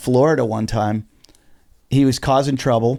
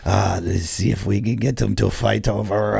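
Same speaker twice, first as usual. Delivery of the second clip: put-on Russian accent; 6 semitones lower than usual. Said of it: "I sound Swedish or something".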